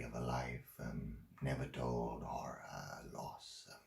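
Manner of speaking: low, soft, slowing with pauses; subdued recollection. Acted. Man recalls Margaret's hidden losses at work, subdued. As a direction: let it sink almost to a murmur.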